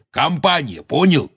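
high, insistent hollering; exasperated shouting. A narrator's reading. Hagrid urging the giant to look down, shouting. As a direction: shout to make a dim giant understand. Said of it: "крикнул Хагрид".

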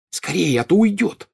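high, pressing urging; urgent hiss. A narrator's reading. Harry urges friends after escaping Malfoy, urgent hiss. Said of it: "прошипел Гарри".

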